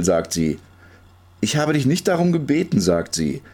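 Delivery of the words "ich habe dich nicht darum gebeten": put-on higher-pitched girl's objection; indignant protest. A narrator's reading. Girl tells the pusher she never asked, protesting.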